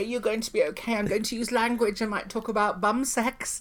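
mock sweet warning. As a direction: warn her gently, as if to a child. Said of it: "And I say".

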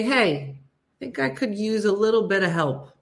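normal talking voice

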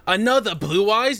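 high surprised squeak; mock surprise. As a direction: act shocked, pitch up. Quoting surprised characters.

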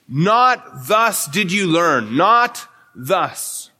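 "Not" leaned on, slow; slow and deliberate, punching "Not thus"; stress on not. Shown as a demonstration.

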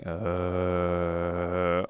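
low flat monster groan; Frankenstein groan. Retold or imitated. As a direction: drop low for a dumb monster uh. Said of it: "a Frankenstein going".

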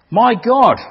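loud anguished howl of God's name. Acted. Man lost in darkness cries to God, howling. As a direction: howl it into the dark. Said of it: "he howled again".

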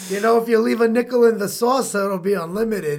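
put-on loud, high caricature Brooklyn accent; big mock New York accent, easing as it goes. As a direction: ham it up as a Brooklyn coffee-counter regular. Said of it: "This is a Brooklyn accent".

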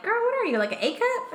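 high, incredulous teasing; mock surprise. To her friend, teasing in a high mock voice. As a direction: tease a friend about her size, up high. Mocking a friend's new boobs.

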